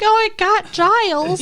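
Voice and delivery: high, drawn-out mock dismay; mock alarm. Co-host guessing the friends' reaction to the wrecked house, mock alarm. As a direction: stretch it out high like a stunned realization.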